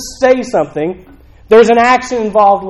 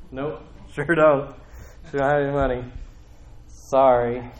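dismissive brush-off; 5 semitones lower, 6 dB quieter than usual. Acted. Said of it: "somebody asks you for money".

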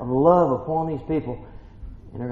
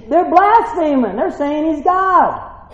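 high, slow, drawn-out outrage; hammed-up Pharisee protest; scandalised Pharisee; 10 semitones higher, 4 dB louder, slower than usual. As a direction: accuse them in mock horror. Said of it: "some of the Pharisees".

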